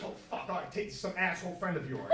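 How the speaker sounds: soft, grumbling aside; annoyed owner mutter. Retold or imitated. The owner handing over the phone, in a soft grumble. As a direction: grumble it under your breath. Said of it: "Giuseppe at this point says".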